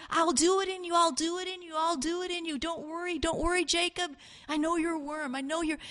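high, pleading reassurance; God the Father crying out. Retold or imitated. The Father reassures Jacob, crying out. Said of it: "the Father crying out".

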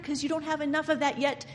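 ordinary delivery

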